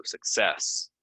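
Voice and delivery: "success" said suk-SESS, slowly; second-syllable stress. Shown as a demonstration. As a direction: lean on CESS in "success". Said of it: "second syllable".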